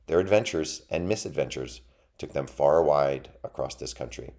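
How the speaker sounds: plain reading voice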